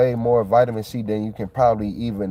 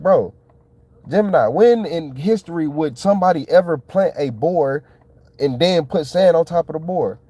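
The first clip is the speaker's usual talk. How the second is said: incredulous; 6 semitones higher, 3 dB louder than usual. Retold or imitated. Incredulous question to the chatbot.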